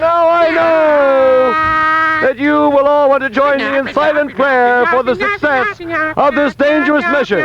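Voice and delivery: high, drawn-out orator's proclamation; mock rally speech. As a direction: address the troops before a grand mission, stretching words. Mock solemn speech to crowd.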